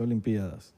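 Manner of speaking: Olimpiadas stressed on PI; hiato, forma esdrújula. Shown as a demonstration. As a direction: correct them with o-lim-PI-a-das for Olimpiadas. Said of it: "Se dice Olimpiadas". (Olimpiadas esdrújula, acento en PI)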